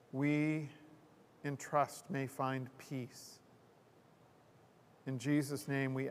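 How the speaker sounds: quiet, measured prayer voice. Real, not acted. Minister leading the congregation in prayer to loving God, quiet prayer. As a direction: pray softly, with pauses between phrases.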